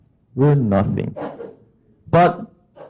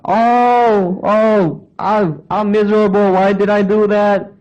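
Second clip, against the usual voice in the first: mock lament; 7 semitones higher than usual. Preacher voicing a regretful sinner, mock whining. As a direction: wail like a sorry sinner.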